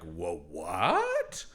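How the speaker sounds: low start swooping up to a question; baffled actor. Acted. Dan Stevens reacting to the dream, hammed-up bafflement. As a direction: play hammed-up bewilderment. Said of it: "Dan Stevens having to go like".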